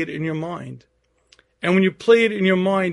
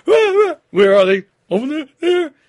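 mock shriek; 10 semitones higher, 9 dB louder than usual. Wife screaming on chair.

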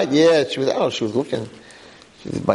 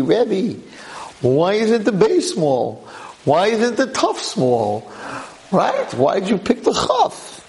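higher, slow puzzled student asking; questioning student; 6 semitones higher, slower than usual. Retold or imitated. Student challenging the rebbe about the letter, higher puzzled voice. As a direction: ask it like a curious pupil. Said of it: "Now you're gonna ask me".

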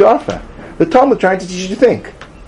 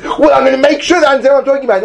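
high, rushed voice of a determined brain; acted-out urgent resolve, pitched up and fast; the brain talking; 10 semitones higher, much faster than usual. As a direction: play the brain scrambling to catch up. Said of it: "So the brain says".

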